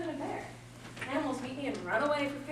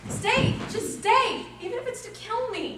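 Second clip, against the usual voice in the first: louder and higher, crying out after him; begging him not to run off; desperate pleading; 9 semitones higher, 5 dB louder than usual. Acted. Helena calling after Demetrius in the dark, louder.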